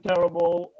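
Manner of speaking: ordinary delivery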